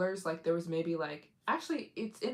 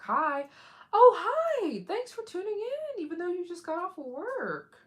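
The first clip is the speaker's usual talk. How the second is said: bright greeting; 13 semitones higher, 7 dB louder than usual. Real, not acted. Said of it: "greeting live viewers".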